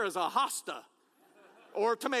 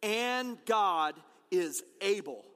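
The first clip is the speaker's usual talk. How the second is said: And God is able, slow with GOD stressed; slow call-and-response; 4 semitones lower, much slower than usual. Shown as a demonstration. Pastor leading the congregation to repeat the phrase, slow. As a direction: lead the congregation word by word. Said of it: "will you say that word with me".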